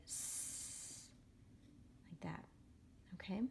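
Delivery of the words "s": S as a long hiss on its own; soft C sound. Shown as a demonstration. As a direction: hold out "s" without a vowel. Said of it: "It sounds like".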